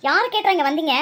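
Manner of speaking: usual speaking voice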